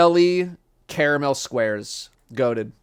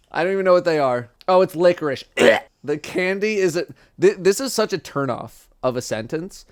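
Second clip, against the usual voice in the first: ordinary talk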